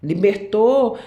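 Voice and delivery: "Libertou" called out with fervour; emphatic call. Real, not acted. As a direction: proclaim "Libertou" like a revelation. Preacher repeating for emphasis.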